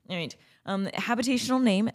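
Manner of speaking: normal talking voice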